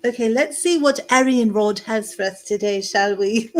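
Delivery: playful mock British accent; jokey British hostess voice. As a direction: put on a proper British accent, amused. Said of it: "that was with a British accent".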